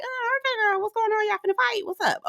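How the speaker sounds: small, high, softened put-on voice; imitated guarded greeting. Imitating an awkward hello before a fight, high and soft. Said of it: "It was kinda like".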